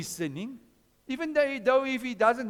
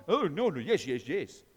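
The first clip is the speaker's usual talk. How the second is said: mild mock devil, sliding lower on the yeses; tempting devil voice; 6 semitones lower than usual. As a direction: coax like a sly tempter. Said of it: "there's another voice".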